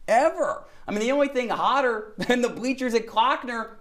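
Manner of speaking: high, hyped mock weatherman; Al Roker. Anchor announcing a record high, playful high weatherman voice. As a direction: sell the record heat like a showy forecaster, high-pitched. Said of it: "put on my Al Roker glasses".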